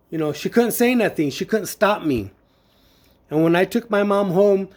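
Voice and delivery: normal talking voice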